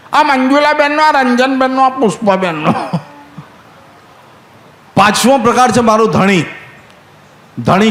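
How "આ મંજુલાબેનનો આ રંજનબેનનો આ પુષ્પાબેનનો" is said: mocking villagers. Villagers mocking henpecked husband.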